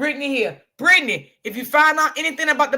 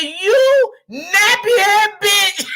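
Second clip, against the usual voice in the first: slow, laughing quote, louder and higher; quote through laughter; 6 semitones higher, 5 dB louder, much slower than usual. Retold or imitated. Laughing hard while repeating the lady's insult to viewers. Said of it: "She said".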